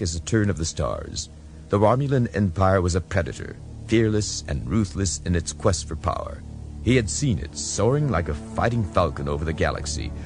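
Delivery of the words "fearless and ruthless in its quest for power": plain reading voice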